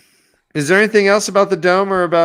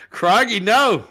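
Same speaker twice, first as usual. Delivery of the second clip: louder, higher broad Aussie; Bondi Australian accent; 5 semitones higher, 4 dB louder, slower than usual. Pretend. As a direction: protest it in put-on Australian. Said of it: "Bondi B".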